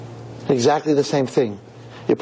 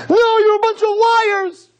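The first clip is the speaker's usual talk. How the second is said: put-on drunk furious shout; 5 dB louder, slower than usual. Drunk rejects owner's apology.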